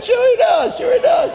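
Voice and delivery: very high, exultant shouted assurance; jubilant shout. Retold or imitated. Preacher reassuring the healed man, jubilant shout.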